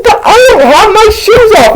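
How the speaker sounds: high falsetto mock protest; protesting friend. Homie denies owning the toenail, high-pitched protest. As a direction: squeal the defence like an upset friend.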